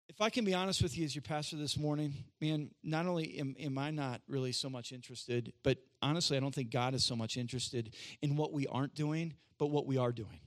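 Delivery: earnest confiding. Real, not acted. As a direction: confide in the church in a low, quiet voice. Pastor's honest confiding aside.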